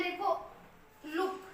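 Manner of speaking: normal talking voice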